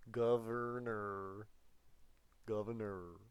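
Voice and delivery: slow, drawn-out mock Arnold; Arnold Schwarzenegger. Teacher repeats Arnold's title, slow and hammed up. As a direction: stretch the word like a movie catchphrase. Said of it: "I'm trying to do an Arnold Schwarzenegger impression".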